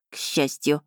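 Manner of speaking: ordinary delivery, nothing marked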